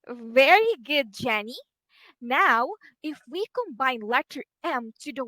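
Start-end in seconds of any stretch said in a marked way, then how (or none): none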